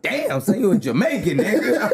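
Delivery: high, laughing imitation of his dad; mock the father, high and laughing; dad teasing his son, high and laughing; dad's voice. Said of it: "He was like".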